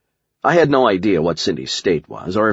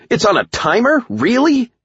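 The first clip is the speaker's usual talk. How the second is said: very high, indignant questioning; incredulous; 4 dB louder than usual. A narrator's reading. Hero discovers the new power runs out, incredulous high protest. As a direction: question it in high-pitched disbelief. Learns the power has run out.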